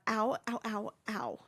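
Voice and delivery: pained ow. Real, not acted. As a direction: gasp it out in real pain. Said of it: "She's kicking".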